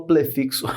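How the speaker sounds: "prefixo" with L for R, plefixo; Cebolinha. Shown as a demonstration. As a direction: swap the r of "prefixo" for an l. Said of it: "Igual cebolinha". ("prefixo" trocando R por L: plefixo)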